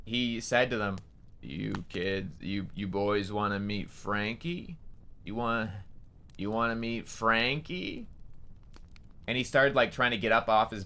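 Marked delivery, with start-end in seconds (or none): 1.4-8.1 slow, low-key gruff stranger. Retold or imitated. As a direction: imitate a gruff, creepy man, softly. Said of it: "in a really gruff voice".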